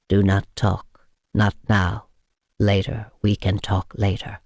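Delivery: gently quiet her down; soothing hush. A narrator's reading. Vampire hushes the weeping woman, soothing.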